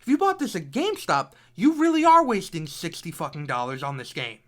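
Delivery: heated rant. Real, not acted. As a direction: scold the buyers hard. Heated rant about price.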